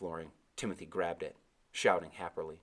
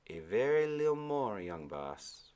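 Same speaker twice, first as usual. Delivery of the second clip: put-on old Caribbean sailor; 3 semitones higher, slower than usual. Old sailor answering the boy, Caribbean accent. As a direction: give Timothy his lilting Caribbean voice. Timothy speaks.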